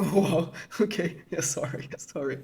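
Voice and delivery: soft, laughing sorry; sheepish laughing apology. Retold or imitated.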